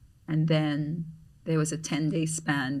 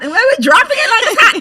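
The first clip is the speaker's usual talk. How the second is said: much higher, louder and fast; excited; 15 dB louder, faster than usual. Real, not acted. Recalling dancing on hot sand, giddy. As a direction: burst out with giddy glee.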